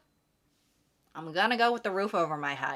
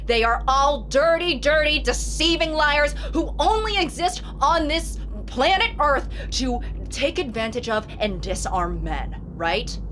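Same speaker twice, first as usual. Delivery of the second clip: mock rant; 5 semitones higher, 4 dB louder than usual. Ranting mock of the post.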